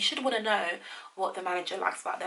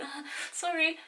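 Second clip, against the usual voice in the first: timid apology; 10 semitones higher than usual. Retold or imitated. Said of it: "your character of you going".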